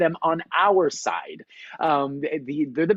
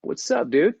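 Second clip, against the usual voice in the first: a laid-back, higher dude voice; nonchalant dude; 5 semitones higher than usual. Retold or imitated. Climbing instructor casually greets the frozen Navy seal, laid-back and nonchalant. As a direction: toss it off like a chill surfer.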